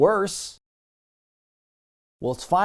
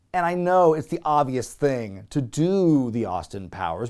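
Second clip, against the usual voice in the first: ordinary delivery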